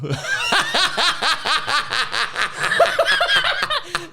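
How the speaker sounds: long, high-pitched cackle; helpless laughter. Real, not acted. Host losing it at his own risky joke, high helpless laughter. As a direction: crack up and keep going.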